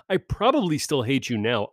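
ordinary delivery

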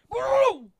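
high, very loud boom; shouted cannon blast. Acted. Making the gun's sound after Fire, loud mock blast. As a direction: explode on the word.

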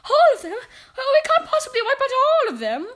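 very high, loud protest; witch in the front row. A narrator's reading. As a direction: squeal it in dismayed disbelief. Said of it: "one witch at the end of the front row saying aloud".

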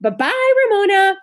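high, loud cooing goodbye; babyish farewell. A narrator's reading. Neighbour says farewell to little Ramona, babyishly. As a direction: call it out high as if to a toddler.

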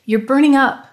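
startled, worried outburst; gasping in alarm. A narrator's reading. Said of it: "Danny took his hand and gasped".

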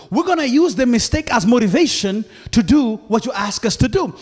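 normal talking voice

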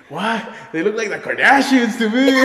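put-on high, loud, laughing drunk voice; drunk disbelief. Drunk friend defending the girls, high laughing voice. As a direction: squeal it in drunk amazement. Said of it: "He's like".

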